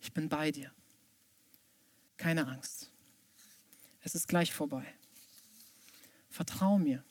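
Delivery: put-on gently calm a frightened child, with pauses; soothing parent. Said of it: "deswegen flüstert er". (leise, beruhigend wie Eltern zum Kind)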